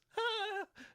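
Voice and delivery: very high nervous laugh; imitated nervous squeal. Imitating Paige's nervous reply, high strained squeak. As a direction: squeak out a mock panicked giggle. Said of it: "she says it like this".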